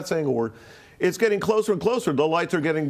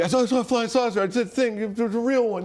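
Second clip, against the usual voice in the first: eager telling; 6 semitones higher, faster than usual. Retold or imitated. Telling everybody for weeks.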